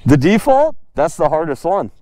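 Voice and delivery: ordinary delivery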